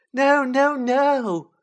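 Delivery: loud, very high elf squeak; high-pitched house-elf protest. Acted. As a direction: squeak it out in frantic refusal. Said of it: "squeaked Dobby".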